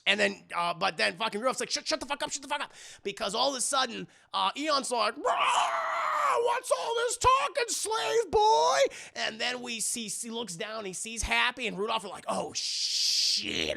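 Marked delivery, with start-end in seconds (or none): 1.7-2.7 urgent hushing. Acted. Rudolph urgently silencing the others. 5.2-8.8 roaring monster villain, high and loud; Eon. Acted. Villain Eon roars in, acted. 12.3-13.8 mock dread. Rudolph sees danger, acted dread.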